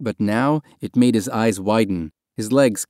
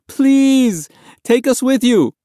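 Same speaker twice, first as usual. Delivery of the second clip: high, loud begging cry; desperate plea; 6 dB louder, slower than usual. A narrator's reading. Boy clinging to the dinghy begs the passengers, desperate cry. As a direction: beg them at full voice. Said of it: "He sobbed with the effort".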